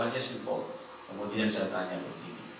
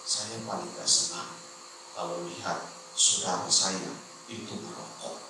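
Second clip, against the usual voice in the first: imitating a humbled man, softer toward the end; voice sinking, humbled; 12 semitones lower, 6 dB quieter than usual. Visitor humbled after being corrected, complaining to the pastor, voice sinking. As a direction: let the voice drop away in mock humility. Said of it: "mulai merendah, hilang suaranya".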